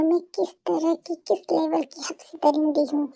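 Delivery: soft low menacing purr; menacing hush. Acted. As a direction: threaten him quietly, teasing. Menacing tease to boyfriend.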